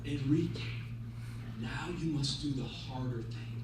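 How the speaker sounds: very low, soft, wise rabbi; solemn counsel. Acted. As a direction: charge him gently, pausing after the name. Wise rabbi gives solemn instruction.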